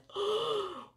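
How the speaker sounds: high, drawn-out gasp; mock horrified gasp. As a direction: gasp in pretend shock. Mock shock at her confession.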